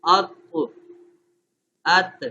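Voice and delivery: اق with rebound, ات without; qalqalah contrast demo. Shown as a demonstration. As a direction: contrast the jolted ق of اق with a smooth ت in ات. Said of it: "ادائیگی کر کے بچوں کو بتائیں".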